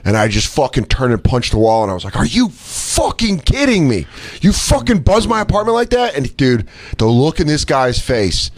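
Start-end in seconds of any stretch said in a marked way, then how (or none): 2.2-6.1 high, incredulous fury; outraged yell. Retold or imitated. Man who punched wall berating driver, outraged high yell. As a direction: lay into him in disbelief.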